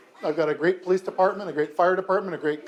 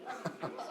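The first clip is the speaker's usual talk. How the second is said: a short breathy chuckle; soft laugh; 17 dB quieter than usual. Real, not acted. Mayor amused by child's pun, quiet chuckle. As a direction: laugh under your breath. Mayor hears mushroom joke.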